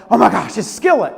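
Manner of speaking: gushing fan spotting a favorite band; excited exclamation. Retold or imitated. Woman to minister on seeing the band poster, excited.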